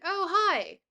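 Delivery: high, loud burst of hello; delighted greeting. Real, not acted. Streamer spots a viewer from Scotland, delighted greeting.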